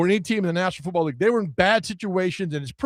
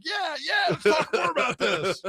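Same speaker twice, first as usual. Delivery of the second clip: high, laughing mock enthusiasm; mock eager reply; 11 semitones higher, 4 dB quieter than usual. Host imitating himself and a colleague, high laughing mock eagerness. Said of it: "me and Doctor Cray are like".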